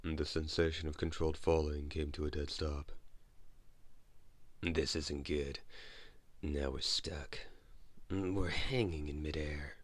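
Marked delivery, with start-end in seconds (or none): 4.6-9.8 hoarse grumbling. Acted. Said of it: "the hoarse voice was done grumbling".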